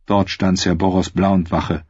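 usual speaking voice

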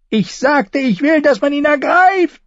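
high, loud insistent command; angry repeated order. A narrator's reading. Joffrey repeating his order to silent lords, loudly. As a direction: repeat the order furious at being ignored.

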